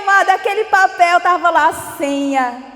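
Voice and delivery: exclamado bem mais alto, cheio de alegria; alegria real. Real, not acted. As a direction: anunciando a vitória para a igreja. Celebra a senha com a igreja. (mostrando a senha à igreja, alto e eufórico)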